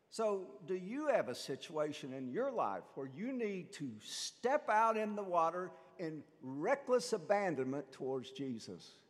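normal talking voice

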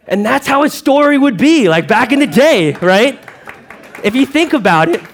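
passionate, swelling sermon voice; fired-up preaching. Real, not acted. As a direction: preach it to the whole room with fire. Preacher builds to climax.

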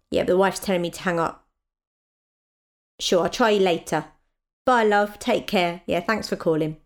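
ordinary talk, nothing marked

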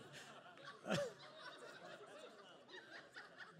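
long real laugh, high-pitched; helpless laugh at your own mistake. Real, not acted. Preacher cracks up at his drawing of Jesus, laughing.

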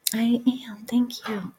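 hushed, grateful whisper; gentle whispered gratitude; soft whispered thanks. Real, not acted. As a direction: thank them barely aloud. Quiet thanks to listener.

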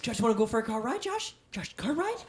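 high, eager, rising questions; hyping up a dog. Pretend. Owner calling Josh for a car ride, excited baiting. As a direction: coax an excitable pet.

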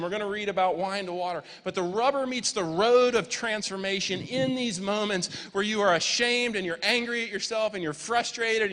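high, loud, forceful preaching; impassioned preaching. Real, not acted. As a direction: drive the point home to the congregation. Preaches the lesson to congregation.

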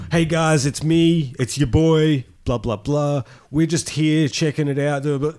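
mock a cheesy vlogger intro; fake enthusiasm. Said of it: "Fake enthusiasm from the get-go".

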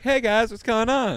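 high, loud breezy hello; mock casual greeting. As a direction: pop back in like nothing happened. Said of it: "I'll be like".

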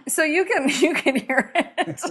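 laughing. Real, not acted. Storyteller letting her guest hear it, laughing. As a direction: talk through a laugh.

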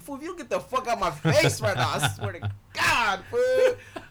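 high, loud outburst; angry threat. Retold or imitated. Kid warns the clown off, loud angry threat. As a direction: threaten the clown at full pitch, building. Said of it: "I was like".

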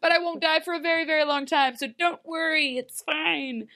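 high, hammed-up breezy reassurance; mock cheery reassurance. Mother reassuring her daughter about dying, mock cheery. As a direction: brush off the grim news in a high, mock-bright voice.

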